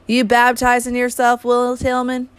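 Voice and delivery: put-on a playful young girl calling out; teasing girl. A narrator's reading. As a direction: tease him for splashing his head. Ruby teasing Will.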